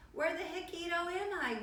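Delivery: high-pitched puzzled question; wondering aloud. A narrator's reading. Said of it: "wondered Scapito aloud".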